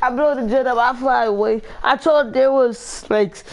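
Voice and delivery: loud, high-pitched and agitated; excited outburst. Real, not acted. Gamer after blowing up his own jet, agitated outburst. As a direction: blurt it out at the top of the range.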